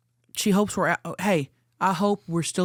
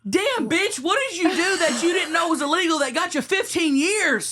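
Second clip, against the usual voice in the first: high, stunned outburst; shocked; 11 semitones higher than usual. Real, not acted. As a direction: blurt it out in disbelief.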